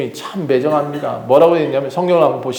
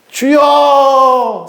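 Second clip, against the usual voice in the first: high, long-held shout to the Lord; desperate cry; 10 semitones higher, 5 dB louder, much slower than usual. Acted. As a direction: call out loud, stretching the last vowel. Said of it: "소리쳤습니다". (길게 부르짖는 외침)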